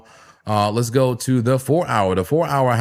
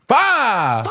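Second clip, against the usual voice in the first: much higher, loud laughing yell of "Five"; laughing catchphrase shout; 11 semitones higher, 4 dB louder than usual. Real, not acted. Streamer shouts his catchphrase at the falling chart, laughing. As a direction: belt the catchphrase out through a laugh.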